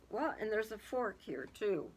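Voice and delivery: ordinary delivery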